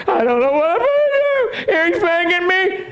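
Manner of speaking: high-pitched mock panicky whine; mock nervous cry, voice way up high. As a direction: play the scared believer, hammed up. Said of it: "we get all nervous and say".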